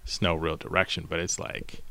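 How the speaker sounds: normal talking voice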